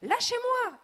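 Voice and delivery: very high, very loud scream. A narrator's reading. Lady held aloft by the robot, screaming. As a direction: shriek to be let go. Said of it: "hurle madame Dupressoir".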